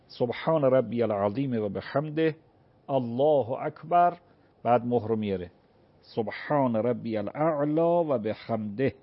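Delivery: slow, measured recitation of the prayer words; slow prayer demo. Shown as a demonstration. Cleric showing a caller how to pray seated, slow recitation. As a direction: recite it slowly as if praying in the chair. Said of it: "به این شکل که روی صندلی".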